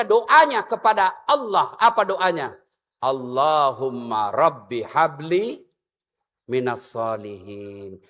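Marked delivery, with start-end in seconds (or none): 3.0-8.0 prayerful recitation. Acted.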